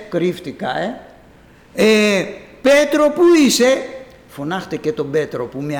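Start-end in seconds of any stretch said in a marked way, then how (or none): 1.7-3.9 high, loud call. Acted. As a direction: call out to him from afar. Christ calling out to Peter. (δυνατό κάλεσμα από μακριά)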